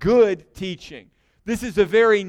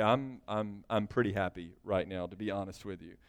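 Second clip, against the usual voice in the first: sheepish admission; 10 semitones lower, 13 dB quieter than usual. Retold or imitated. Sheepish quoted reply, very soft.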